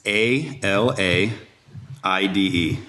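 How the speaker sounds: slow, letter-by-letter spelling; spelled out. Shown as a demonstration. Spelling an unusual name for the host, slowly. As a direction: spell it out slowly, pausing midway. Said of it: "And spell it for us".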